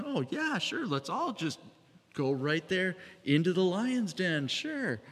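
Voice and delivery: high mocking sarcastic voice. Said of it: "a little bit sarcastic".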